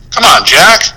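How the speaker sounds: ordinary talk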